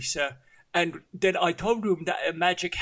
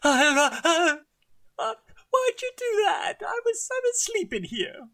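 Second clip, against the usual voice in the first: put-on high, squeaky startled voice of a young man just woken; 12 semitones higher, 3 dB louder than usual. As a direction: jolt awake and squeak in protest.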